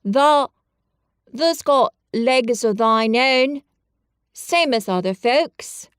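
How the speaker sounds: slow, firm Yorkshire dialect; steady a friend in broad Yorkshire; Yorkshire lad. Acted. Dickon tells Colin about his legs, broad Yorkshire.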